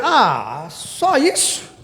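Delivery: mock incredulous question; doubtful surprise. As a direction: scoff as if it's too simple. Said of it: "Talvez você olhe assim".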